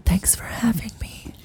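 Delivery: whispery, breathy cartoon voice; soft airy whisper, playfully affected; put-on cartoon voice. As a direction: whisper it like a delicate cartoon character. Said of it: "doing that voice a lot".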